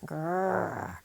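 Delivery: soft breathy playful "Grrr"; a happy little growl to end the line; cheerful growl. Acted. Jake telling the birds he is happy, playful growl. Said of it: "Jake growled cheerfully".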